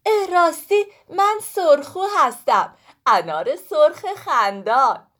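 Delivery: high, bright self-introduction; cheerful pomegranate. Acted. Sorkhoo introduces herself to Woolak, high and proud. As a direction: announce your name proudly.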